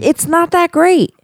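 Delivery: incredulous. Real, not acted. Mock-outraged disagreement.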